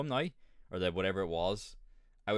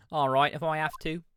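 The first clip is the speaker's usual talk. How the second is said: lightly voiced urchin, higher and louder; street urchin; 7 semitones higher, 7 dB louder than usual. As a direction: give in as the young witness. Voicing the reluctant girl witness.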